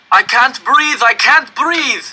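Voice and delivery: high, loud, desperate, slowing on the repeat; crushed prisoner crying out for air; panicked cry. Acted. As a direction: gasp it out in rising panic. Narrator panics in crushed car.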